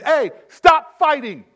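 high, loud parent yell. Retold or imitated. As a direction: yell at the kids to stop. Parent yelling at kids.

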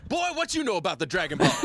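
high, fast sassy challenge; teasing high-pitched comeback; mock tough challenge.

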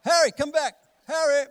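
loud, high shout across the park; calling the dog. Retold or imitated. Owner shouting for runaway dog Harry, loud high call. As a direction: yell for the dog to come back.

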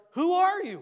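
high, loud startled question; Saul. Acted. Saul answering Jesus from the ground, high startled cry. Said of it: "you know what Saul's next words are?".

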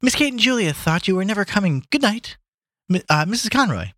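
high-pitched maid welcoming guests; Lily. Acted. Maid to late guests and his wife, high welcome. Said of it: "said Lily to Gabriel".

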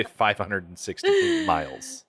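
disbelieving emphasis on the mileage; incredulous. Real, not acted. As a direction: stretch it out in disbelief. Incredulous at the walking distance.

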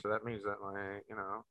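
very soft and low, fading away; quiet, downcast trailing off; subdued, sad. Real, not acted. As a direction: let the sad thought sink almost to nothing. Trails into dread about dog.

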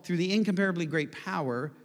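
normal talking voice

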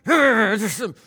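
loud, high-pitched growl of mock rage; frustrated growl. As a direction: let out an exaggerated "grrr" of frustration. Acts out bottled-up anger.

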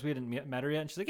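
usual speaking voice

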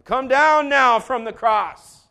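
loud, drawn-out shout; shouted taunt, stretched out; crowd's jeer. Acted. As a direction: call it out slowly and forcefully. Acting the mocking crowd at the cross.

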